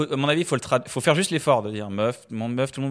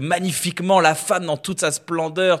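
higher, mock-lofty flourish; grandiloquent; 5 semitones higher than usual. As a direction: exalt her with theatrical pomp. Mimes the exalting effect of "femme. (emphase grandiloquente, voix plus haute)

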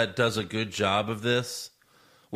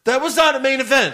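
indignant; 11 semitones higher, 7 dB louder than usual. Real, not acted. Indignant disagreement.

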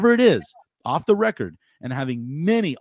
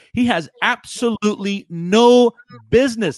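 higher and louder, exasperated; angry rant; 7 semitones higher, 8 dB louder than usual. Real, not acted. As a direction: blast the roster decision. Ranting about roster choice.

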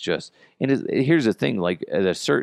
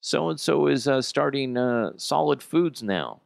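gushing proud parent; 8 semitones higher than usual. Retold or imitated. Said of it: "and he's like".

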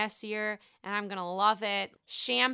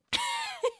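high giggle. Real, not acted. Laughing at the odd remark.

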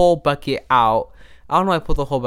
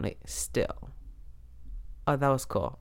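breathy whisper to hold still; a whisper to keep the microphone still; 12 dB quieter, slower than usual. Real, not acted. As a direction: whisper so the mic stops shaking.

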